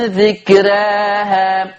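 ذكراها drawn out slowly, tajweed recitation; recited dhikraha. Shown as a demonstration. Teacher models ذكراها slowly for students. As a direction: say ذكراها slow and measured. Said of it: "قول ذكراها".

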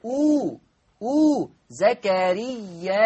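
"أو" als kurzes "u" betont, langsam; "زكريا أو" Hamza mit u-Laut rezitieren; Hamza mit Damma. Shown as a demonstration. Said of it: "هتضم الهمزة تصير أو".

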